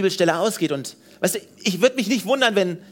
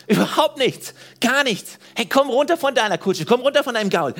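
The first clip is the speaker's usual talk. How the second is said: higher-pitched, emphatic go-ahead; eager urging; 6 semitones higher, 3 dB louder than usual. Acted. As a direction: urge them on eagerly, pitched up. Acts out Philip's eager answer. (begeistert drängend, mit höherer Stimme)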